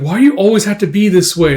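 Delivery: high, loud upset complaint; exasperated reproach. Acted. Casca reproaches Guts for his behaviour, exasperated.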